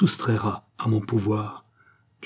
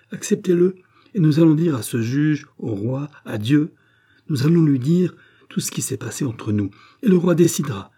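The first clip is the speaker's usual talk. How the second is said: higher and louder, pressing hard; raised, forceful challenge; 5 semitones higher, 4 dB louder than usual. Acted. Gilbert defying the countess before the king, loud and forceful. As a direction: throw the challenge in her face.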